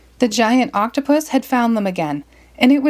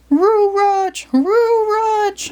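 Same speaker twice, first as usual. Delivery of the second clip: much higher dog voice, R for T and M; Scooby crying out; 12 semitones higher, much slower than usual. Acted. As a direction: howl "Roo Rutch" like a cartoon dog. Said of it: "Scooby cried".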